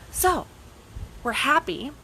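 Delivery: ordinary delivery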